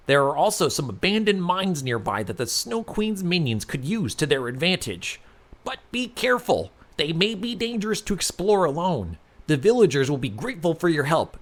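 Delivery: lightly voiced village leader giving advice.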